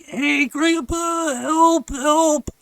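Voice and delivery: high, loud, drawn-out mock cry for help; retold screaming. Boy in the hole to Grandpa, slow high retold shout. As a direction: imitate yelling up from far down. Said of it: "That's when I start screaming".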